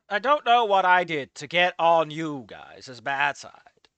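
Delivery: ordinary delivery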